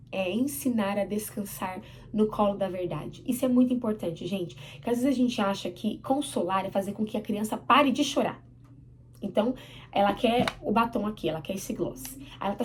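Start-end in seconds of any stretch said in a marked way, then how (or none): none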